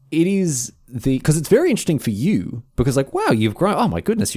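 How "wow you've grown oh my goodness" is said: put-on higher-pitched gushing at a child; mildly hammed-up amazement; mock amazed adult. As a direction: act astonished at how much they've grown. Acts out adult greeting a child.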